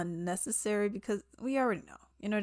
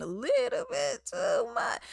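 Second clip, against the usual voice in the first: teasing exaggeration. Real, not acted. Mocking an actor's overdone accent.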